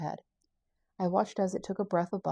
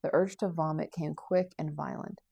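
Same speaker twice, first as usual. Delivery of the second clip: ordinary delivery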